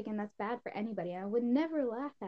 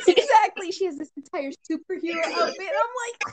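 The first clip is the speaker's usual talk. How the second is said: laughing while talking; 10 semitones higher, 9 dB louder than usual. Real, not acted. Cracking up retelling the scene.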